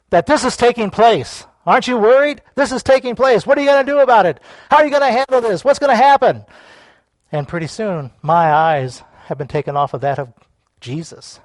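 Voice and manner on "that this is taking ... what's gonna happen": high, pressing questions; raised, agitated inner voice; acted worry. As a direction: pile up anxious questions. Voicing the worried inner mind.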